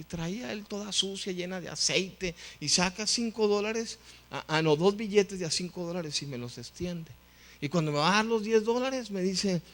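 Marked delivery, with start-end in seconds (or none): none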